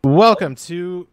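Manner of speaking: slow, loud, grand opening; host intro. Acted. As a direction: open the show like an announcer. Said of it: "oh my God, [name]".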